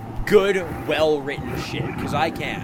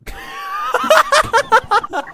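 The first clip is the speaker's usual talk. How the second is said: high screaming burst of laughter; loud shrieking laugh; 7 dB louder than usual. Real, not acted. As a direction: cackle up into a scream.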